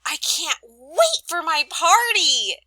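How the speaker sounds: high, loud and slowed; teacher demonstrating excitement for an exclamation mark; excited. Shown as a demonstration. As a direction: gush about the party. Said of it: "because I'm so excited".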